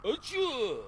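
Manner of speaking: sharp loud sneeze. Acted. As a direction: sneeze it out hard. Loud sneeze mid-sentence.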